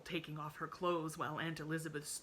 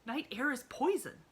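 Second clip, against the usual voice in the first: scandalised aunt; 8 semitones higher, 6 dB louder than usual. A narrator's reading. Aunt lectures.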